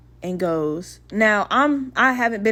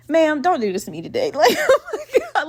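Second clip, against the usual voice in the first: laughing protest; 7 semitones higher than usual. Retold or imitated. Flight attendant to a lady passenger, laughing protest. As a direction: protest climbing into high-pitched laughter.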